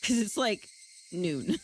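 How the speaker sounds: ordinary talk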